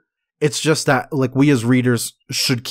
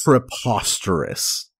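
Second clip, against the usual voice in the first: lofty disdain; 3 semitones lower than usual. Shown as a demonstration. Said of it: "think of ridiculous in this context more as".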